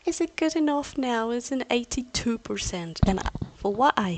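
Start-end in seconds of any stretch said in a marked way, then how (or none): 0.1-1.3 higher, louder test of the mic; pushing the voice for the mic. Shown as a demonstration. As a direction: say it up, checking the level. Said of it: "I'm not going to scream".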